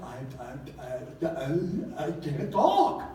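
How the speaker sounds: stammering, stuck on the first word; mock stammer. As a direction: fake a nervous stutter, tripping over I. Moses stammers excuses to God.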